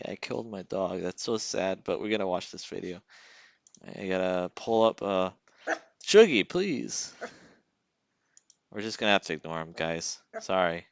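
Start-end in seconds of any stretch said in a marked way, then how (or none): 6.1-7.1 exasperated plea. Real, not acted. Pleading with the misbehaving dog.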